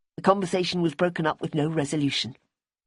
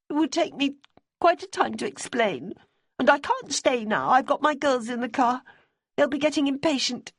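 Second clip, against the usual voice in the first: higher, pleading woman's voice; Debbie's voice; 10 semitones higher than usual. A narrator's reading. Debbie asking Simmy about Bonnie's boyfriend, high and earnest. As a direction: voice a worried, earnest widow.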